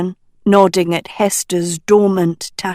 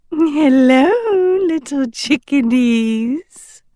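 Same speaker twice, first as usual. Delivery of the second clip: higher, lilting sing-song greeting; sing-song call; 7 semitones higher than usual. Acted. Uma greeting the trapped crew, sing-song call. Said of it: "a sing-song voice called".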